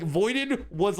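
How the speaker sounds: normal talking voice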